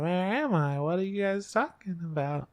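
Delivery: put-on high confused girl voice. Waking heroine asking where she is, high bewildered voice. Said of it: "she's like".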